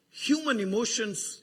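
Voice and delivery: low, intimate pastoral hush; hushed tender preaching. Acted. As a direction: speak it gently, almost to yourself. Soft reflective stretch of sermon.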